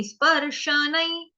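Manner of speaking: स्पर्शनई spelled out slowly; slow pronunciation. Shown as a demonstration. Teacher modelling a word for students, slow. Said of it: "इसका उच्चारण क्या होगा".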